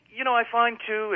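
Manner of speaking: usual speaking voice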